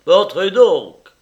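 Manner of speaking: loud, slow, high welcome; hearty shout to come on in; exclaiming. A narrator's reading. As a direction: call it out big and drawn out. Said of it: "S'écria Lyra".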